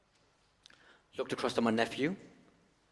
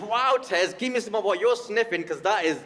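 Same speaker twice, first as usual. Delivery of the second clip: higher, louder mock disbelief; incredulous heckler; 10 semitones higher, 6 dB louder than usual. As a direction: ham up an amazed audience member. Voicing incredulous audience.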